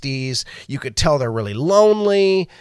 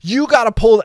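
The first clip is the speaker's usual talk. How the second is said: higher and louder, urging the friend; retold urgent plea, raised voice; urgent call; 6 semitones higher, 7 dB louder than usual. Urging a friend to pull the pic, loud and high. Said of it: "I'm like".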